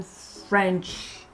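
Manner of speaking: ordinary delivery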